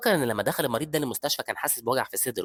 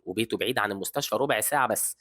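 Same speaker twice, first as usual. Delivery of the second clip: usual speaking voice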